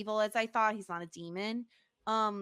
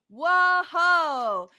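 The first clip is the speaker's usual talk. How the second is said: loud, higher, amazed exclamation; a genuine stunned whoa; surprised whoa; 7 semitones higher, 7 dB louder than usual. Real, not acted. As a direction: blurt it out in real astonishment. Reacting with shock to a scene.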